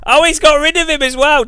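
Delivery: high, loud alarmed cry; shocked cry. Real, not acted. Streamer to chat, a high shocked cry. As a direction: yelp in real dismay.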